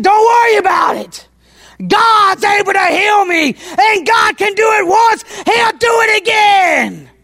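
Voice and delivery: soaring pulpit proclamation; preaching faith. Acted. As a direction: declare God's healing power to your wife. Preacher answering his wife.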